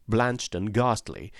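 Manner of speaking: plain reading voice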